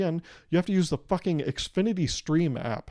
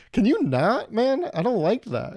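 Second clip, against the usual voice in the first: grossed out; 7 semitones higher, 4 dB louder than usual. Retold or imitated. Disgusted at flirting MacBook.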